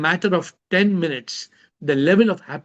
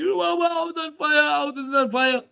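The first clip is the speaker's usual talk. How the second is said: high hammed-up wail; wife wailing over the fire, imitated, pitched high; mock crying wife; 10 semitones higher than usual. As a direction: voice the wife sobbing about her house. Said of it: "The wife is crying".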